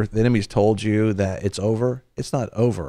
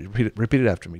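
ordinary talk

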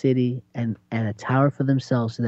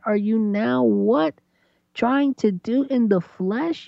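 high, slow hammed-up reproach; mock incredulous scolding; 9 semitones higher than usual. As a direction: scold like a baffled parent, pitched up high. God scolding the proud.

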